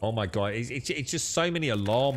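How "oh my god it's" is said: ordinary talk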